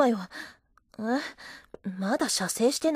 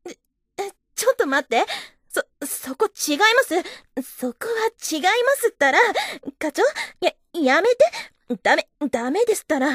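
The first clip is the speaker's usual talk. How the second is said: higher, louder, startled pleading; flustered rising panic, begging him to wait; alarmed protest; 5 semitones higher, 3 dB louder than usual. Acted. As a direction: stop him in sudden alarm.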